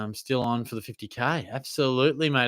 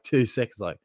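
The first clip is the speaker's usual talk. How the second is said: normal talking voice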